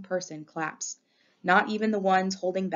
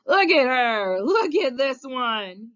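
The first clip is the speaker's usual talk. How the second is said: put-on high, loud drunken bellow, last words drawn out; hollering; 6 semitones higher, 8 dB louder, much slower than usual. A narrator's reading. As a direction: holler to show someone off to a crowd. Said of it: "he hollers".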